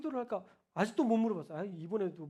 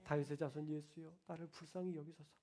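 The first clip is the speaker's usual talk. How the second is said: soft, lower pleading prayer; humble plea; 4 semitones lower, 10 dB quieter than usual. Shown as a demonstration. Pastor modeling the prayer for the congregation, soft. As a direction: beg gently for mercy. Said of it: "여러분도 그런 기도 드리셨으면 좋겠습니다".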